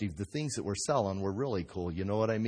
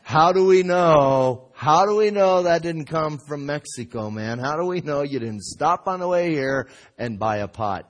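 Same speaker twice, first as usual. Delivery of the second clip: mock heckling shout; 3 semitones higher, 10 dB louder than usual. Skeptical audience challenging the potter's pot, heckling shout. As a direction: call it out like a doubting heckler.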